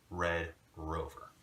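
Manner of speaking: "Red Rover" slowly, with heavy English r's; harsh English R. Shown as a demonstration. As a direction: round the lips on each r of "Red Rover". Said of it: "they say R's very harshly".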